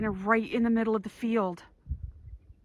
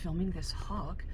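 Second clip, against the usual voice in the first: whispering; 7 dB quieter than usual. Real, not acted. Whispering inside wildlife blind.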